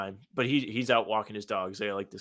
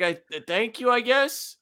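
flustered; 10 semitones higher than usual. Retold or imitated. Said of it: "I was like".

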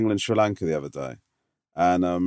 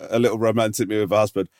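usual speaking voice

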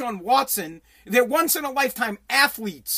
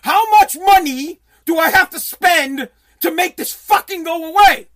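owner venting; 5 semitones higher, 8 dB louder than usual. Retold or imitated. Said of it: "The owner says".

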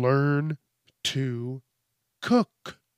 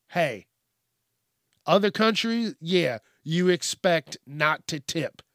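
normal talking voice

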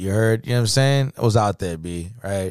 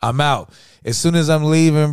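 usual speaking voice

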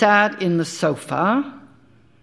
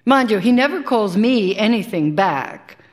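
ordinary delivery

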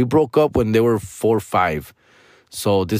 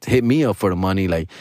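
usual speaking voice

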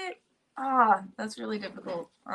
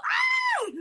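short shrill mock scream, pitched very high; imitating a friend's girlish scream; imitated little girl scream. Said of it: "a little girl".

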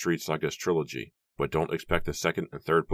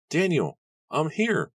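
desperate shout; 12 semitones higher, 5 dB louder than usual. Acted. Nora tells Daniel she escaped, high shout. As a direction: shout so someone trapped can hear you. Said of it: "Nora shouted".

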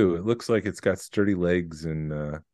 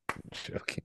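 whispered joking; whispered aside; 17 dB quieter than usual. Real, not acted. Host taking back the back-rub joke, whispered. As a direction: drop to a whisper to take it back. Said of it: "No, I'm joking".

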